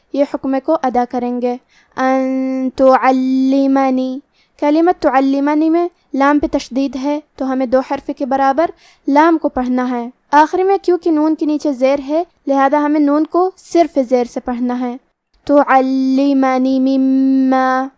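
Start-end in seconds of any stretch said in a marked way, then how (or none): 2.0-4.2 ان تعلمنی very slow, noon hidden with nasal hum; ikhfa with ghunna. Shown as a demonstration. Teacher demonstrates ikhfa for students, very slow. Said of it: "نون ساکن کو چھپاتے ہوئے اور غنہ کے ساتھ". 15.4-17.9 مما very slow, meem held doubled. Shown as a demonstration. Teacher models shadda on meem, slow recitation. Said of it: "میم کی تشدید کو ظاہر کریں گے".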